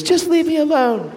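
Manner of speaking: imitating a crying young woman; upset waitress. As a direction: brush him off in a high, upset voice. Crying waitress pushes him away.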